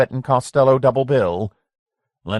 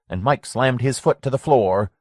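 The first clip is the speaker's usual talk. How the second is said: ordinary delivery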